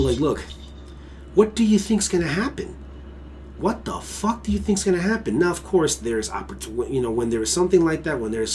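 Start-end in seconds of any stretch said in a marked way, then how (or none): 0.2-5.4 raised, pleading what do you think; higher pitched disbelief, pressing the point; exasperated warning. Retold or imitated. Telling people at the protest, exasperated and raised. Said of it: "I'm telling people".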